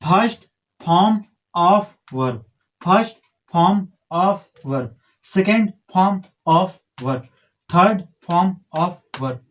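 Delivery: very slow, word by word; spaced-out teacher's demonstration, pause after every word; model reading. Shown as a demonstration. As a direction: recite each word separately for students to copy. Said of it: "इसको पढ़ते हैं ऐसे".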